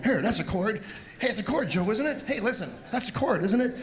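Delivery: high, bright-eyed mom showing off a chord; a delighted mother proving she found a chord; excited mother. Retold or imitated. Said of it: "And she's going".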